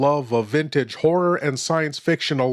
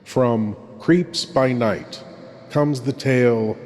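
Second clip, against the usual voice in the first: hushed horror host; 2 semitones lower than usual. Acted. Horror host introduces tonight's tale.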